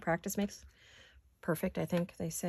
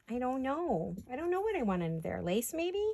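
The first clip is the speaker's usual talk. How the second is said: usual speaking voice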